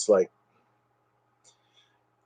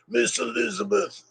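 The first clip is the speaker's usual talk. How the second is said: Macho Man voice; 10 semitones higher, 8 dB quieter than usual. Pretend. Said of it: "I can usually do him".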